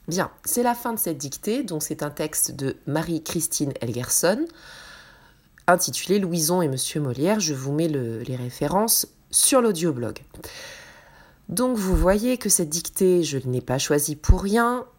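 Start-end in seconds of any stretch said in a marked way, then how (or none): none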